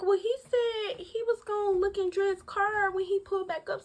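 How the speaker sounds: high, timid excuse voice; high gullible self-quote; naive self. Retold or imitated. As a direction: squeak it out gullibly.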